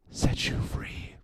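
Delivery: slow, voiceless whisper. Pretend. Comic imparting mock wisdom on truth, slow whisper. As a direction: whisper it slowly for dramatic effect.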